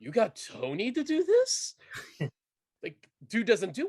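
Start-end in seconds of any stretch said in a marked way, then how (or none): 0.0-1.8 high-pitched, incredulous question; retold disbelief. Host recalling hearing about Tony's casting, incredulous. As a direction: voice climbs in mock astonishment.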